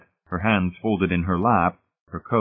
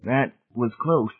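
slow, higher, thin voice; 5 semitones higher, slower than usual. A narrator's reading. Laws relieved after a close call, thin-voiced. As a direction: draw it out, shaken. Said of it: "Laws said thinly, his hand shaking".

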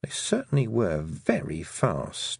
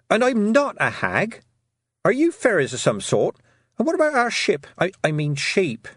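high, raised voice; indignant calling out; 11 semitones higher, 4 dB louder than usual. A narrator's reading. Tiffany challenges unseen fairies at the door, indignant calling out. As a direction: call out a challenge, pitched high.

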